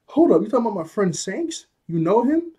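high, incredulous retold questions; startled questioning. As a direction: voice jumps up in surprise. Luffy startled to hear of friend.

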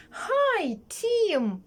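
very high, sing-song greeting; Tom. Acted. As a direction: squeal a friendly hi, stretched out. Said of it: "Що на це відповів Том?". (високе співуче привітання)

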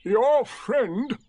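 high, loud, disdainful donkey voice; Eeyore. A narrator's reading. Donkey remarking on the tiger, high and disdainful. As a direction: announce it with dry disapproval. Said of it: "said Eeyore".

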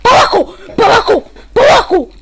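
high, loud repeated bursts; shouted command. Real, not acted. Player ordering someone down in the game, urgent shouting. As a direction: yell it down, one burst at a time.

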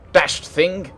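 upper-class English curse. A narrator's reading. An artificer curses over his work, upper-class English tones. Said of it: "he cursed in upper-class English tones".

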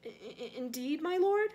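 high, stammering servant voice; nervous servant. A narrator's reading. Servant Wormtail answering his lord, high nervous stammer. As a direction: stammer upward into a startled question.

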